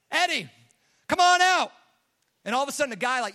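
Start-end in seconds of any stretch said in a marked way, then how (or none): 0.1-1.7 loud, high-pitched shouted summons; calling out. Acted. Pastor calling the dead man from casket, calling out. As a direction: shout to someone shut away.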